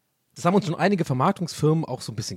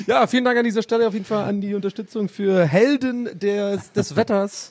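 mock sponsor read; 6 semitones higher than usual. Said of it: "wenn das jetzt alles eine Werbung gewesen wäre".

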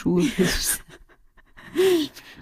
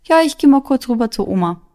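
lightly voiced mom, higher and breezy; a hint of a casual mom; mother's casual voice; 5 semitones higher, 4 dB louder than usual. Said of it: "Und dann hat sie mir mitgeteilt".

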